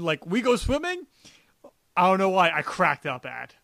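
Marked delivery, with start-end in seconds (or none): 0.3-1.1 high-pitched, innocent question; Bulk, genuinely asking. Retold or imitated. Retelling a flooded-panel scene, a genuine, clueless question. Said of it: "Bulk's, like, genuine".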